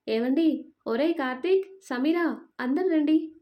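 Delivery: loud calling out. A narrator's reading. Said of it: "అని గట్టిగా అరిచింది".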